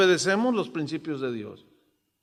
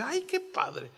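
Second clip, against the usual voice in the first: delighted wife, soft; 9 semitones higher, 7 dB quieter, slower than usual. Retold or imitated. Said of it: "ella también me dice".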